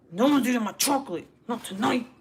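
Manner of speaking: defiant outburst guarding your chocolate; emphatic warning. Real, not acted. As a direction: lay down the law at full voice. Repeats warning, raising voice.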